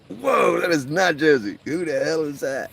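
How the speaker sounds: high, loud, stunned retelling through laughter; shocked. Retold or imitated. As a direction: gasp it out like you just got caught. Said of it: "I was like".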